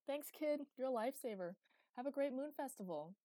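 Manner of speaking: high, loud, cheery send-off; calling goodbye. A narrator's reading. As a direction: call back thanks while leaving. Boy calls goodbye as he leaves.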